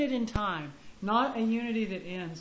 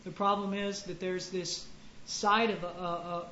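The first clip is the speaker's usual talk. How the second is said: ordinary talk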